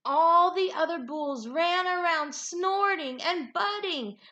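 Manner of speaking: lively, expressive storybook reading; animated read-aloud with big swoops in pitch; reading to children. A narrator's reading. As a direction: read it like a picture book for a class. Teacher reads picture book aloud.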